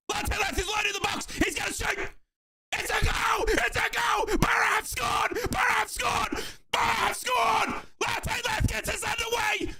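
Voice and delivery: high, sustained commentator's roar; ecstatic goal call. Real, not acted. Commentator celebrates Middlesbrough's opening goal, ecstatic shouting. Calling his team's goal live.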